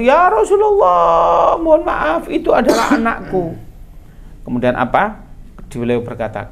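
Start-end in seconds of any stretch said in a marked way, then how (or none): 0.0-3.5 desperate plea. Acted. Mother begging the Prophet for her son, desperate cry. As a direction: cry out to stop them, voice high.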